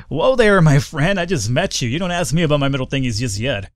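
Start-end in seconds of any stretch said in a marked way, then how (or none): 0.1-1.2 startled. Real, not acted. Reacting to odd NPC.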